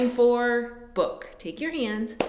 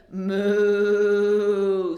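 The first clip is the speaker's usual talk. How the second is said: lower, drawn-out moo; cow mooing; 5 semitones lower than usual. Acted. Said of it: "said cow".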